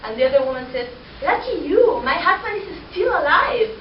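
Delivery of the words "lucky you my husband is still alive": higher, louder rueful retort; wife envying a widow's luck; envious quip. Acted. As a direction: grumble that he's still alive. Woman's envious punchline.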